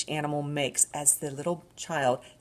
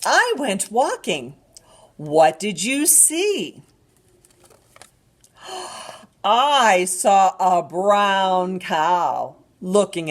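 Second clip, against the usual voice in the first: slow, animated picture-book reading; drawn-out storytime voice with gasps; reading to a toddler; 7 dB louder, slower than usual. Acted.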